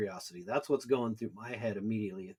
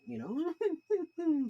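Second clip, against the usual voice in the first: high fake sobbing whimper; badly faked crying woman; imitated woman crying. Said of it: "terrible impression of a woman crying".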